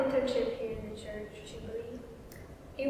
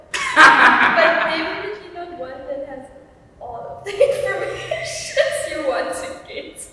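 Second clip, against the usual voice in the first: loud giggly talk breaking into laughs; laughing through words; 5 semitones higher, 12 dB louder than usual. Real, not acted. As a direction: talk while cracking up.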